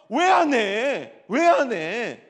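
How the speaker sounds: loud, slow, teasing push of the question; loud prodding. Real, not acted. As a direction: call it out loud, half joking. Pastor prods a shy musician.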